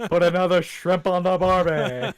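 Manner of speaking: loud, high hammed-up Australian; mock Aussie accent. Teasing Australian listeners, a hammed-up Aussie accent. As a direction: bellow it like a tourist-ad Aussie. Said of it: "the only thing I can say Australian".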